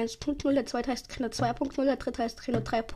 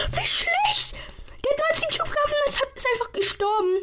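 shocked disbelief; 4 dB louder than usual. Real, not acted. Disbelief at teammate's death.

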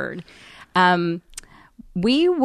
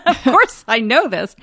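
louder, laughing duh; flippant; 4 semitones higher, 8 dB louder than usual. Retold or imitated. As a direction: toss it back with a laugh. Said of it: "I was a little flippant".

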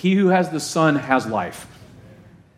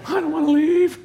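put-on high whiny child's voice, much higher; whiny kid; 11 semitones higher than usual. Pastor mimics kids who won't leave church, high whiny child voice. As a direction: whine it like a kid dragged from church. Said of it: "They don't wanna leave church".

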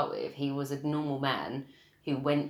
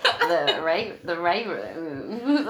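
slow, laughing southern drawl; a playful southern drawl, half laughing; mock southern drawl; 6 dB louder, much slower than usual. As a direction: stretch the words out like McConaughey, giggling. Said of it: "He'd be like".

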